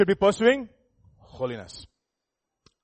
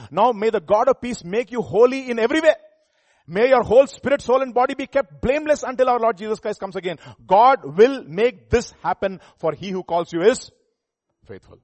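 loud, raised preaching voice; forceful scripture reading; 6 semitones higher, 10 dB louder than usual. Acted. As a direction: proclaim the verse with full force. Reads scripture aloud, preaching.

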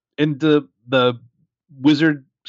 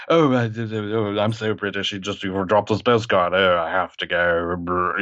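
lower, slow and stammering, an over-polite British fluster; mock Newt; 7 semitones lower, slower than usual. Podcaster mocking Newt leaving, lower and slow. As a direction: mumble it out like an awkward English boffin. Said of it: "and he's like".

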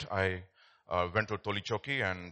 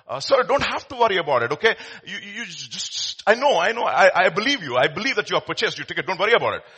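louder, higher, laughing insistence; eager reassurance; 6 semitones higher, 9 dB louder than usual. Acted. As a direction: wave away his worry with a laugh. Collector reassuring frantic Einstein.

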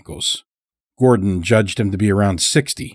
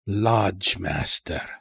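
put-on slow, softer old-timer echo; savoring the title; 7 dB quieter, much slower than usual. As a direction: draw it out quietly, trying it on. Old captive savours the title.

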